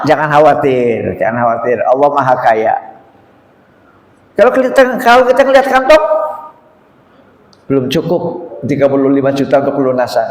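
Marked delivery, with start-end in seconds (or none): 4.3-6.0 high, anxious, a bit louder; fretting pilgrim. Retold or imitated. Preacher mimics a man checking his pocket, high and fretful. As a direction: sound alarmed looking in an empty pocket.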